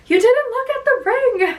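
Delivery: mock guy voice. Mocking her fiancé's ring question in a high put-on voice. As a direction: tease him by parroting his worry in a squeaky put-on voice. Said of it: "such a guy thing to be like".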